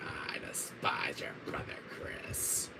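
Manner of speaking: hushed, menacing villain; low, whispery contempt; Wesker. Acted. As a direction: say it as a villain under the breath. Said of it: "my Wesker voice".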